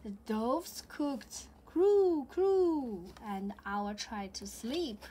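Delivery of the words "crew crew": loud high cooing; dove coo. Acted. Dove sound in storybook.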